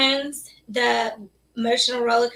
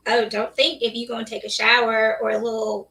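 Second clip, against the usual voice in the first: normal talking voice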